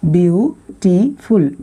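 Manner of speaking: "Beautiful" very slowly as view, टी, फुल. Shown as a demonstration. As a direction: stretch each of the three beats in "beautiful". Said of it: "थोड़ा धीरे बोल के देखिएगा".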